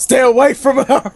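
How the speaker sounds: hammed-up shout; mock shout. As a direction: yell it like a guard breaking them up. Acted guard shouting at animals.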